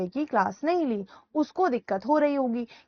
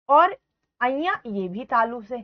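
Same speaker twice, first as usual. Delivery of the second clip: normal talking voice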